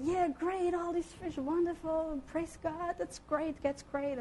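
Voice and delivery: lightly voiced bystanders cheering from shore; cheering crowd. Crowd on shore cheers the full boat, lightly mimicked. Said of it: "they're cheering".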